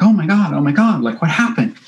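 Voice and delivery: high, loud panicked cries; imitating her hysterical alarm; mimicking the model freaking out in the street, high panic; retold hysterical panic. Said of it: "she's hysterical also".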